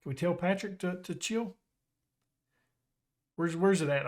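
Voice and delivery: usual speaking voice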